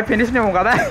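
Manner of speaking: words breaking into a laugh. Real, not acted. Downed player sees the enemy struggling, laughing. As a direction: laugh at your own trouble. Laughs while being finished off.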